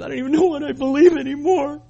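a hint of a tearful young man; imitating crying student. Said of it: "he was crying".

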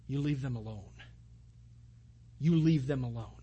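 quiet warning. Retold or imitated. Paul warning magistrates to leave the church, low and soft. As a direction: lean in and warn them low and steady.

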